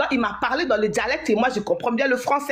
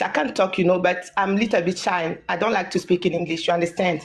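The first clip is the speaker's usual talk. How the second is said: normal talking voice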